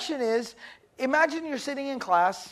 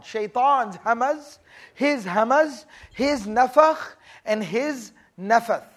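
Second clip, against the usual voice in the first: each word drawn out slowly for the class; slow emphasis; 4 dB louder, slower than usual. Shown as a demonstration. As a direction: lay out the three Arabic words, slow and deliberate. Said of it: "spelling out the three words".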